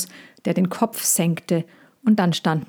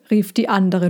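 usual speaking voice

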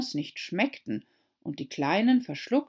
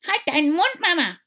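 high, loud snippy retort; 12 semitones higher, 9 dB louder than usual. A narrator's reading. Young crab to her mother, loud snippy. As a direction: snap at mother. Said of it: "ein wenig schnippig".

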